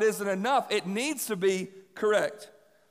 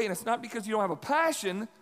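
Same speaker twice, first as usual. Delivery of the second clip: ordinary delivery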